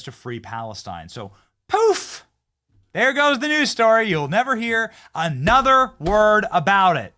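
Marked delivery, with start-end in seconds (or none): 1.7-2.3 explosive, high-pitched poof; loud high "poof". Pretend. Host making the news story vanish, exclaimed poof. 2.9-7.1 high, loud proclamation, slowing down; mock send-off. Host waving off a vanished news story, loud mock send-off.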